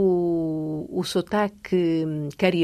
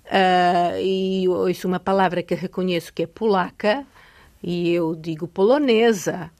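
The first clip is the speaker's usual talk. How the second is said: ordinary delivery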